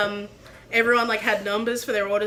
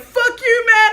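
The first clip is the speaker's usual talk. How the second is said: put-on high-pitched kid's jeer; mock defiant yell; 10 semitones higher, 7 dB louder, slower than usual. Little kids jeering at chef as they flee, high mock yell. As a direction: shout back before running off. Kids yelling back, retold.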